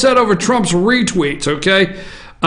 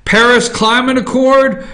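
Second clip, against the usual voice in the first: ordinary delivery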